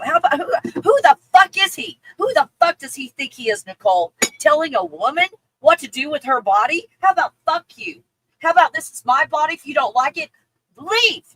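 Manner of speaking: loud, indignant ranting with punched words; angry rant. Real, not acted. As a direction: tell him off at full volume, outraged. Indignant rant at commenter's boyfriend.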